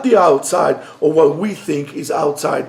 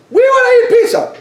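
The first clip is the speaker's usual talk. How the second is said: put-on very high, loud childish answer; kids' eager voice; 7 dB louder, faster than usual. Kids loudly telling father they want pizza, very high. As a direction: chirp it like an excited kid.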